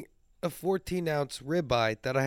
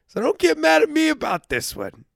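playful rant; 11 semitones higher, 6 dB louder than usual. Real, not acted. Ranting at vegans.

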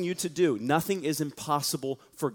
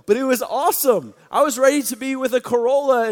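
much higher and louder gushing; excited; 9 semitones higher, 10 dB louder than usual. Real, not acted. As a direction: burst out with joy about the car. Gushing about the upgrade.

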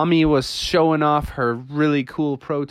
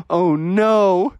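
loud gasp, then higher "oh no"; dismayed gasp; 5 semitones higher, 4 dB louder than usual. Acted. Family discovers it is Father Frog, dismayed. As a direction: gasp in shock and lament. Family finds dad under sheet.

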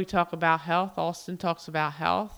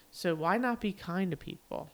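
ordinary delivery

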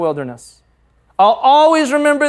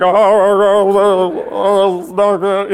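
imitating blubbering sobs, slowing down; hammed-up bawling; half-joking imitation of his own sobbing; mock ugly crying; slower than usual. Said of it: "ugly crying".

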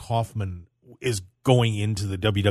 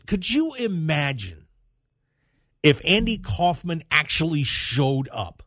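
slow and higher, stretching each word; drawn-out emphasis; 6 semitones higher than usual. Real, not acted. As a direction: savor the question, drawing it out. Repeats the question for emphasis.

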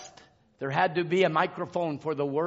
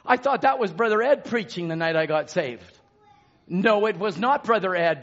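ordinary delivery

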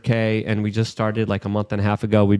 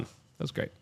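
whispering; 4 semitones higher, 15 dB quieter than usual. Real, not acted. Aside after the sign-off.

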